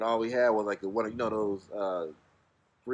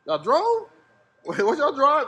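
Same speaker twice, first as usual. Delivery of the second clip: much higher, louder, laughing disbelief; amazed host; 14 semitones higher, 6 dB louder, slower than usual. Retold or imitated. Host shocked they drove, high and laughing. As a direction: play his shocked laugh.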